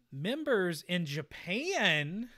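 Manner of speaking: usual speaking voice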